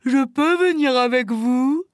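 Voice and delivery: eager bear voice, rising at the end; exclaiming bear. A narrator's reading. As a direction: ask keenly as the bear to come along. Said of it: "s'exclama l'ours". (ours qui demande avec enthousiasme)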